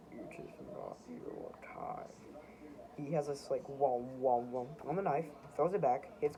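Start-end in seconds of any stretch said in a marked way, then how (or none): 0.1-2.1 low, slow, breathy whisper of death; a slow, low reaper whisper; Grim Reaper voice. Acted. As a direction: speak slowly as the Grim Reaper. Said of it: "the Grim Reaper".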